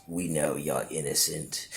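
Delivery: low, soft, ominous jailer voice; cold jailer. Retold or imitated. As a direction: drop it low and quiet like a cold warden. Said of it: "And the dude goes".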